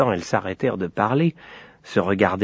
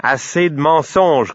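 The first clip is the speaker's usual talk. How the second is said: raised, drawn-out scolding; fed-up father cutting them off; angry cry; 6 semitones higher, 3 dB louder than usual. A narrator's reading. Father cuts off his crying daughters, angry. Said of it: "cria Papa Saïd".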